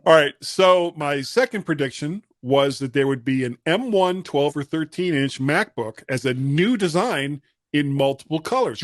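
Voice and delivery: ordinary talk, nothing marked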